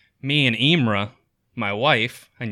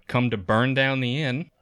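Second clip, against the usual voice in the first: usual speaking voice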